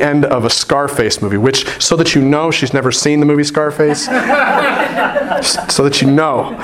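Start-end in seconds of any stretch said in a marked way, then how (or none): 4.1-5.2 laugh. Real, not acted. Laughing at daughter's joke.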